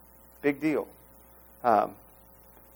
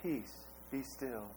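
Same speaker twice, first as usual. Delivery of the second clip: soft, slow, sanitized "peace, be still"; mock-genteel hush; prim and proper; 2 semitones lower, 20 dB quieter, slower than usual. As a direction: say it gently and politely. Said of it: "It's so nice and proper".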